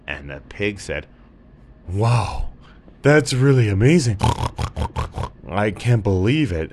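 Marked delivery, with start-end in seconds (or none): 1.9-6.7 a higher, louder wow of wonder as the pig; amazed pig. Acted. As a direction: gasp at the tale like a wide-eyed pig.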